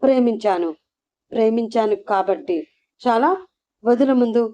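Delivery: ordinary delivery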